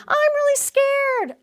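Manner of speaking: mock scared child. Child admitting fear to parent, whiny. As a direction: whine like a frightened kid. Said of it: "How about".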